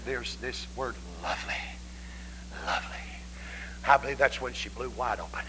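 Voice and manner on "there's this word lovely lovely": softer, whispered "lovely"; hushed whisper. Retold or imitated. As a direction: breathe the word lovely. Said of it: "Then she said".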